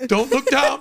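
a hammed-up hiss to himself; inner warning to self. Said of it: "I'm like".

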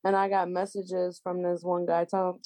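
usual speaking voice

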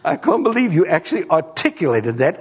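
lightly voiced stunned parent; amazed. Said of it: "And it's kinda like".

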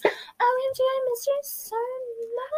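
high, slow gushy mock greeting; gushing schoolgirl. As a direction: squeal it like a friend overjoyed to see you. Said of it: "everyone was like".

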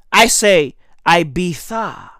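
"Ibiza" as eye-BEE-tha; lisped TH Ibiza. Shown as a demonstration. Comic stating his own way, "Ibiza" with TH. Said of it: "I'm gonna pronounce it with a tha".

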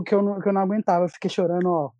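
ordinary talk, nothing marked

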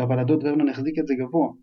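plain reading voice

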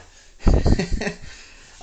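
loud, high burst of laughing; real high-pitched laugh, bursting out; burst of laughter. Real, not acted. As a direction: crack up at your own crude joke. Cracks up at own joke.

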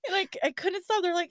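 normal talking voice

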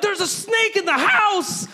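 high, loud alarm; husband warning his wife, shouting in panic; panicked shout. Retold or imitated. Alarm call about snake.

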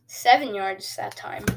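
ordinary talk, nothing marked

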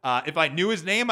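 ordinary delivery

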